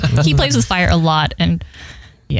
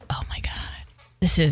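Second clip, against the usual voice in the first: acted near-whispered amazement; whispered mock reverence; hushed awe; 6 dB quieter than usual. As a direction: drop to a very soft, awestruck hush. Acts out awe at Boston accents.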